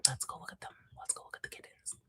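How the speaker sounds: hushed, very soft whisper. Real, not acted. To viewers before opening kitten photos, whisper. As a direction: whisper as if creeping up on something precious.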